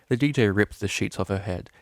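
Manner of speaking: ordinary delivery, nothing marked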